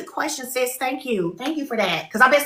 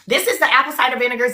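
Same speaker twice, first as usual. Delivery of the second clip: ordinary talk, nothing marked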